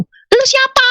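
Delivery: very high, drawn-out demand; indignant challenge. Retold or imitated. Woman demanding who the voice is, indignant.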